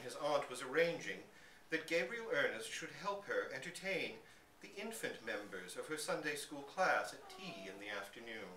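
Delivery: plain reading voice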